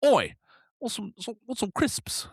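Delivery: high-pitched mock British; badly faked British street call; bad British accent. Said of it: "a British accent".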